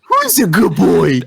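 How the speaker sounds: slow, sing-song dog praise; baby talk. Retold or imitated. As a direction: coo it to a puppy. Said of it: "That's exactly what it was like".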